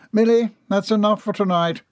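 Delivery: high-pitched motherly order. A narrator's reading. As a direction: send the child off firmly, pitched high. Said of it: "Missus Peabody ordered before turning".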